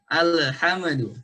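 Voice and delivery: slow "alhamdu" with the l bounced, like "alahamadu"; wrong bounced lam. Shown as a demonstration. Teacher demonstrating the wrong qalqalah on lam. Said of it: "terkadang dipantulkan huruf lamnya".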